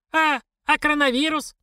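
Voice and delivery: high-pitched startled query; bewildered old woman. A narrator's reading. Old woman asking the stranger about the virus, bewildered.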